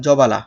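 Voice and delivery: জবালা with the b sounded; three syllables, জবালা as jo-ba-la; wrong pronunciation. Shown as a demonstration. Teacher showing what we do not say, জবালা with an audible b. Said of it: "আমরা তো বলছি না".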